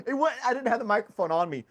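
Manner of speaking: words broken up by real laughter; laughing, high and loud; laughing while talking. Real, not acted. To his listeners about the bathroom mic, laughing through it.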